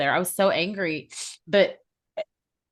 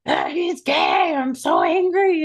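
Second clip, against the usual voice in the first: imagined angry outburst; 9 semitones higher, 4 dB louder, slower than usual. Retold or imitated. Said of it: "I wanted to go".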